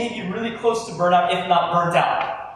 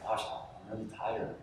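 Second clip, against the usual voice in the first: much softer, almost breaking; drained and near tears; 10 semitones lower, 17 dB quieter, faster than usual. Shown as a demonstration. Acting out the really-tired stage for the crowd, much softer and trembling. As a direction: barely get it out, lips quivering. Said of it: "your lips quiver a little".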